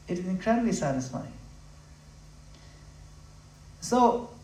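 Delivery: usual speaking voice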